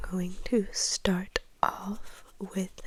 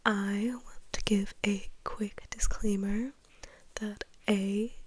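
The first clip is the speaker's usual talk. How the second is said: ASMR whisper; 7 dB louder than usual. Real, not acted. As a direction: whisper it close and intimate. Whispers throughout ASMR vlog.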